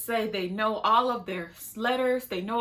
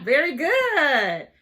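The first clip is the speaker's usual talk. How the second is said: praise; 4 semitones higher, 7 dB louder, much slower than usual. Real, not acted. Praising a student in session.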